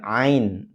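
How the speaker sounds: "ain" made at the Adam's apple; mid-throat ain. Shown as a demonstration. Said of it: "seolah-olah di situ ya". ("ain" dari sekitar gulu menjing)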